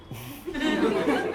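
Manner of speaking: high burst of laughter; laughing. Real, not acted. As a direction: break into laughter. Laughing at dad story.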